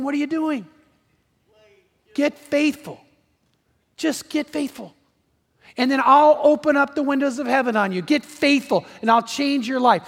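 high-pitched, urgent divine challenge; the Lord exhorting. Retold or imitated. The Lord telling the churches to get faithful, urgent exhortation.

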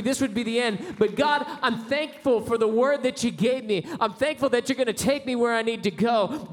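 full-force gospel whoop; impassioned preaching. Acted. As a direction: pour it out like a revival climax.

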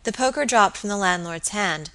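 usual speaking voice

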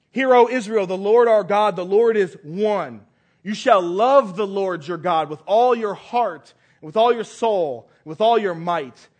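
loud, measured proclamation of scripture; solemn scripture reading. Acted. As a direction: read it out boldly to the congregation. Scripture read aloud.

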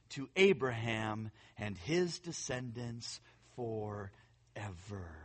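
low, soft, reverent reading; soft scripture reading. Acted. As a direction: read it like a quiet prayer.

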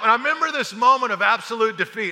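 ordinary talk, nothing marked

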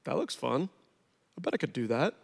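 quiet tempting thought; tempted inner voice. Retold or imitated. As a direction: murmur it like sin whispering. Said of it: "we're like".

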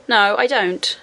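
ordinary delivery

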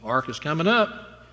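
usual speaking voice

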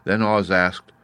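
plain reading voice

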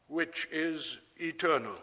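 ordinary talk

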